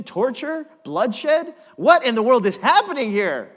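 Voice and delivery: escalating astonished preaching; rising outrage. Real, not acted. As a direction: build from torture to a shouted question. Building outrage question.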